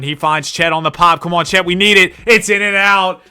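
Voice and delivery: pleading for the shot to drop; excited call. Acted.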